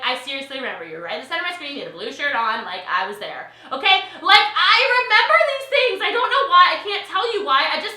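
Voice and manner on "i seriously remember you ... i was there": rapid recall. Retold or imitated. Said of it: "I'll be like".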